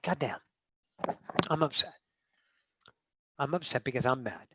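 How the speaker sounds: low-key, softly spoken realization; quiet admission of upset. Acted. A self facing its upset, quiet admission.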